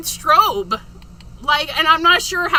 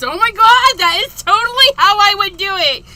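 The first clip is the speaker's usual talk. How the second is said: much higher and louder excited gush; excited squeal; 6 semitones higher, 9 dB louder than usual. Retold or imitated. Host quotes a viewer's thrilled reaction to the head bob. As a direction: gush it out, thrilled and fast at the end. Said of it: "Shannon goes".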